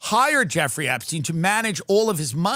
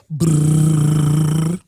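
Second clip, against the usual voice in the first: rolled brrr like a ringing phone; 3 semitones lower, 7 dB louder than usual. Acted. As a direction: imitate a ringing phone with the lips.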